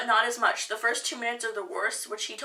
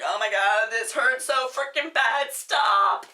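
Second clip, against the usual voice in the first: mock pained outburst; 4 dB louder, slower than usual. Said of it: "I was like".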